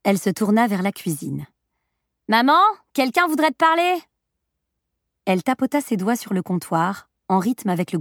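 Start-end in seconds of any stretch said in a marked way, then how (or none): 2.3-2.6 first call to mother, rising high. A narrator's reading. Daughter first calls toward the kitchen. 2.9-4.0 high-pitched message called to another room; calling to mother. A narrator's reading. Daughter calls message into the kitchen.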